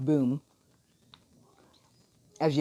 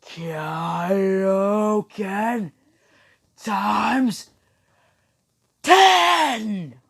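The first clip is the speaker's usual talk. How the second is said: halting power-up yell, rising to a peak; high, full-force anime attack cry; battle scream; 7 semitones higher, 7 dB louder, much slower than usual. Acted. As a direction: scream each word separately, biggest on the last. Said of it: "As he screams".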